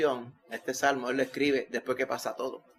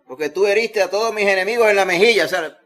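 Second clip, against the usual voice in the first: shouted, higher preaching cry; fervent loud preaching; 6 semitones higher, 10 dB louder than usual. Acted. Preacher proclaims David's prayer in a loud, fervent cry. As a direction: cry it out to God with full force.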